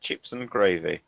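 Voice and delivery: low, soft Southern English accent. Shown as a demonstration. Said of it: "say it in a southern accent".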